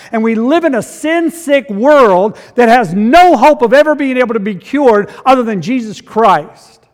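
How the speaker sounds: loud, high, driving sermon voice; fiery pulpit climax; preaching at full force. Real, not acted. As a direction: preach it hard, building fire over sin and death.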